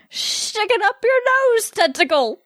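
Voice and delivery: put-on slow, slurred drunken storytelling. Drunk Vex boasts to Bo, slow slurred speech. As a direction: draw it out drunk, rising high. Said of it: "much more capable of using correct speech".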